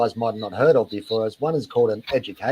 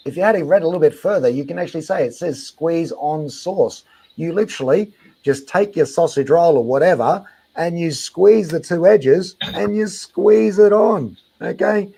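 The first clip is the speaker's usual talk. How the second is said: condescending explanation; 5 semitones higher, 4 dB louder than usual. Real, not acted. Aussie explaining a squeeze packet, condescending.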